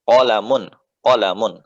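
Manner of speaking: "Qalamun" with a short first a, said loud and crisp; short vowel. Shown as a demonstration. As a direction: clip the a in "Qalamun", pronounce it firmly. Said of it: "Ya, pendek ya".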